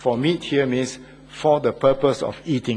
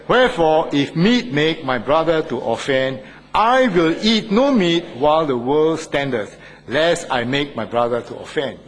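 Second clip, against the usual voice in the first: louder, measured congregational reading; scripture read aloud together; 3 semitones higher, 5 dB louder than usual. Shown as a demonstration. Pastor leading the church in reading scripture, loud and measured. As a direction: read the verse out with the flock. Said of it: "let's read this together now".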